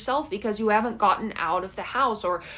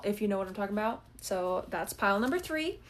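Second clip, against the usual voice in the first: ordinary delivery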